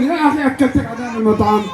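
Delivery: ordinary talk, nothing marked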